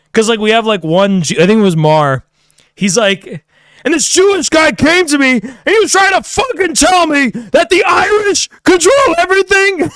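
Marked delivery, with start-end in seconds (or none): 3.8-9.9 high, loud mock rant that rises to a shout; Lucas Gage raging. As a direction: ham up a furious rant, voice climbing high. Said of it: "He was raging".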